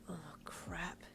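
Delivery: hushed, gasped thought; internal gasp. Acted. Patient remembering the parents, softly gasping inwardly. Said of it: "you gasped internally".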